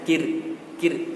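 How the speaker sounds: "kir" with a light, brief R; thin final R. Shown as a demonstration. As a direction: say "kir" with just a slight thin R. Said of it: "sedikit saja".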